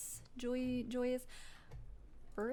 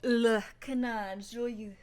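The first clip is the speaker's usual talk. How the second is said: slow, loud French pronunciation; attempted French accent; 7 dB louder, slower than usual. Player reading a tavern name, attempted French accent. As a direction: put on a laboured French accent. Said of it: "I can't do a French accent".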